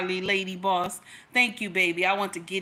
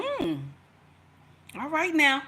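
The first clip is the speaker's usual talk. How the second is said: imitating warning husband; 6 semitones higher than usual. Husband letting his wife know he disapproves, mock stern. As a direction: put on a husband's skeptical warning. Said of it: "Now, he didn't say it like that".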